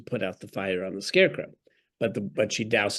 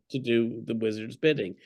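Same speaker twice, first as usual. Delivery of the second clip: ordinary delivery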